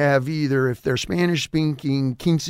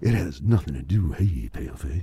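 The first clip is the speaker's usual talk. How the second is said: low smooth radio DJ; 9 semitones lower, 5 dB quieter than usual. Shown as a demonstration. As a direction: croon it low like a late-night DJ. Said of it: "that's my D J voice".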